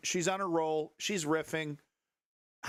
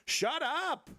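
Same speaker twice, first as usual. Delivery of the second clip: yelling at chat; 12 semitones higher than usual. Pretend. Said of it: "spray these chats down with a hose".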